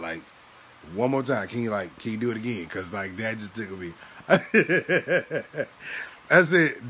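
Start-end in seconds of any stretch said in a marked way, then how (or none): 4.0-6.2 high loud burst of laughter. Real, not acted. Laughing again at the memory of the blinking. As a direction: crack up.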